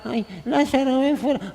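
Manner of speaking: mock a faith without charisma; half-hearted plea to the tomb; weak, timid call. Shown as a demonstration. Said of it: "a veces nos falta carisma".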